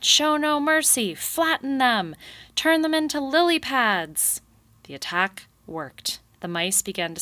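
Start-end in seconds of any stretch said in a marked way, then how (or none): 0.0-4.4 high, loud war cry; shout. A narrator's reading. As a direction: command the beavers, loud and high. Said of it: "Mister Kanad shouted".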